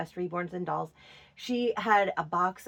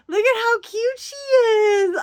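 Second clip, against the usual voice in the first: much higher, louder gushing squeal; 13 semitones higher, 9 dB louder than usual. Real, not acted. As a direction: squeal it out, delighted. Gushing over a disliked doll.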